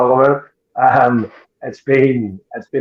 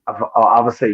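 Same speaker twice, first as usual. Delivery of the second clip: ordinary delivery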